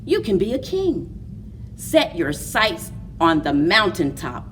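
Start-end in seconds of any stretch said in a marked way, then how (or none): none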